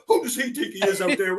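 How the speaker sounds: high, indignant mock grumble; mocking brothers. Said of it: "the two faces going".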